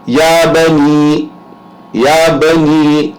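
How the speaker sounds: یا بنی with a short یا, slowly and clearly; teacher models یا بنی with an unlengthened یا; short ya in ya bunayya. Shown as a demonstration. Teacher demonstrating Quranic recitation to students, slow and careful. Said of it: "اس کو لمبا کرنے کی ضرورت نہیں ہے".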